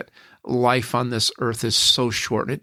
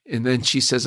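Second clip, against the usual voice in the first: normal talking voice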